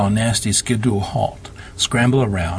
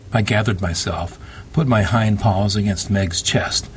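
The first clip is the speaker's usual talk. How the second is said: usual speaking voice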